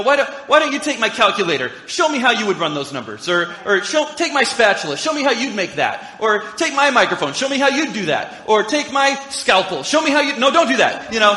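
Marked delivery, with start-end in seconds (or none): 0.0-10.4 louder, higher, building sarcastic dare; sarcastic challenge. Retold or imitated. 10.4-11.1 louder, higher mock no; sharp mock refusal. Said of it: "imagined reply to intruder".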